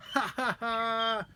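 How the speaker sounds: high, slow drawn-out laughing; helpless laughter. A narrator's reading. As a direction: laugh out loud at him. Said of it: "both were helpless with laughter".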